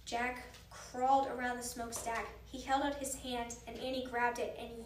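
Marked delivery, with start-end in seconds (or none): none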